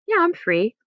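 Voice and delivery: high, bright mock reply; cheery yes. Shown as a demonstration. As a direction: answer like a happy friend.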